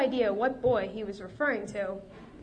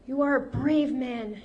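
faked tremble; 3 semitones higher, 6 dB louder than usual. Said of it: "letting her voice tremble".

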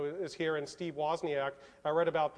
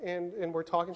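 ordinary delivery

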